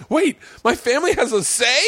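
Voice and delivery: high-pitched, baffled question; mock incredulous. Every comic hearing family comes first, mock disbelief. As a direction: act astonished, voice jumping way up.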